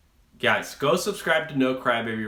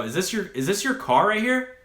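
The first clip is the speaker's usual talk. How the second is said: retold angry challenge; 8 semitones higher, 4 dB louder than usual. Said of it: "And I was pissed".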